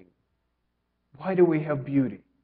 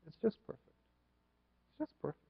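much softer, lost in the moment; hushed inner thought; 11 dB quieter, much faster than usual. Retold or imitated. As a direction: murmur it as if remembering. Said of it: "I remember being there and thinking".

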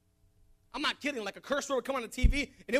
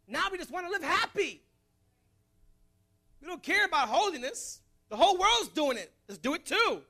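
higher, emphatic preacher's cadence; impassioned preaching; 7 semitones higher, 5 dB louder than usual. Real, not acted. Preacher lamenting the church's lost devotion, impassioned. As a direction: preach it with rising heat.

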